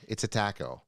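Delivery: ordinary talk, nothing marked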